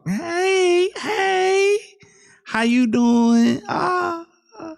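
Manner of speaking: high squeaky mocking girl voice; mocking girly voice. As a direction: imitate her in a whiny high voice. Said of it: "Look at that girl talk".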